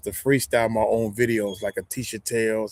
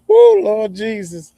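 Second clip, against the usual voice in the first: high, loud, slow startled cry; real jolt of fright, drawn out; frightened exclamation; 10 semitones higher, 9 dB louder, slower than usual. Real, not acted. Jumping at a moving leaf, loud frightened cry.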